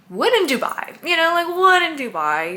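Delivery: high, loud mock-excited exclamation; playful self-persuasion. Quoting own self-talk while shopping.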